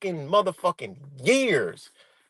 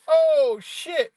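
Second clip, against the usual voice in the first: high, sudden "oh shit" as it sinks in; the moment he realizes it's over; startled curse as the police are called; shocked realization; 12 semitones higher than usual. Retold or imitated. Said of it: "I'm like".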